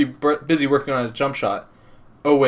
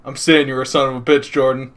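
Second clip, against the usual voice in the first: ordinary talk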